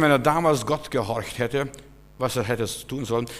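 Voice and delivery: normal talking voice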